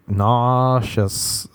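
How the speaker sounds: "Nauseous" with a very long stretched first vowel; drawn-out complaint. Acted. Interrupting whoever is trying to help, "Nauseous" stretched long. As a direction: draw out "Nauseous" slowly, NAAAU-shus.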